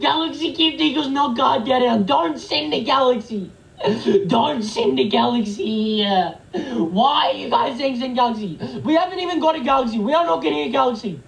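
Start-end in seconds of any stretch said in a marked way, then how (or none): 0.0-8.6 slow, drawn-out exasperated wail; wailing protest. Real, not acted. Streamer protesting at viewers threatening galaxy gifts, wailing. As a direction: drag the words out like a tantrum.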